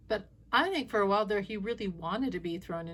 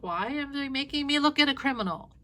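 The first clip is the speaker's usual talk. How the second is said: mock indignant outburst; 6 semitones higher, 5 dB louder than usual. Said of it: "you're like".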